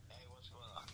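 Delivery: tinny phone call audio; on speakerphone. Real, not acted. We're gonna put you on speaker.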